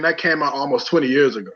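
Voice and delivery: ordinary talk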